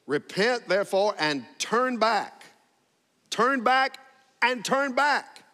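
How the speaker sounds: high, emphatic "turn back", hit hard each time; "Repent" and each "turn back" punched high; emphatic repetition. Shown as a demonstration. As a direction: drive "turn back" home by repeating it, pitched high. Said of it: "it's like an emphatic statement".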